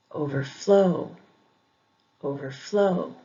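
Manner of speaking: overflow with FLOW stressed; stress on second part. Shown as a demonstration. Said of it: "emphasis on the second syllable".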